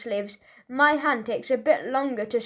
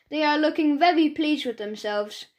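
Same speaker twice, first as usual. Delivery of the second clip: ordinary delivery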